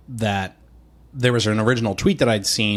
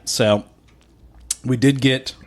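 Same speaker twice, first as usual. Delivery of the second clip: ordinary talk, nothing marked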